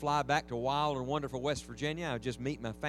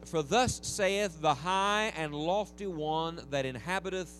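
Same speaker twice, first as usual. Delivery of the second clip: slow, solemn reading of the verse; scripture reading; 3 semitones higher, slower than usual. Acted. Preacher reading Isaiah aloud to his congregation, solemn and slow. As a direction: read it out with reverent weight.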